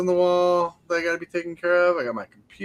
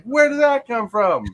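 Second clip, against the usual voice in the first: higher, slow bewildered question; mock surprise; 8 semitones higher, 5 dB louder, slower than usual. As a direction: act startled by it. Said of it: "it's like".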